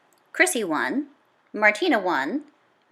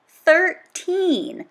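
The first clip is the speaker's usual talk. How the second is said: higher and louder, punching Thirteen!; excited exclamation; 7 semitones higher, 4 dB louder than usual. A narrator's reading. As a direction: marvel at the streak out loud.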